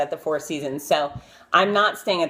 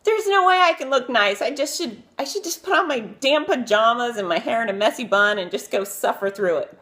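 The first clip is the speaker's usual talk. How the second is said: frazzled self-talk; 8 semitones higher than usual. Retold or imitated. Getting ready to meet Brooke Shields, exasperated and frazzled. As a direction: throw up your hands at the mirror. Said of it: "and I thought".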